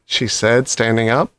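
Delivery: ordinary delivery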